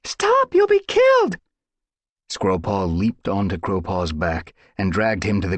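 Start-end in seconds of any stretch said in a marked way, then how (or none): 0.0-1.4 very high, loud cry to stop him; desperate yell. Acted. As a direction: scream him back from danger. Cat yells to stop him.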